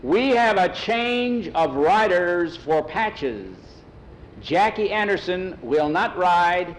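slow, high, drawn-out announcement; stretched-out racetrack call; megaphone announcer. Acted. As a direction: project each word across the grandstand. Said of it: "they heard on the megaphone".

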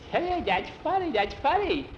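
high, squeaky giggly voice; snickering. Acted. Boy sees friend's ears wiggle, snickering squeal. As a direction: squeal it as if fighting off laughter. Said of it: "Skeeter starts snickering".

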